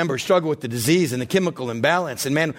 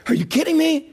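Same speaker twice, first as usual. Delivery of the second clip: exasperated disbelief; 8 semitones higher than usual. Retold or imitated. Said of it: "they're like".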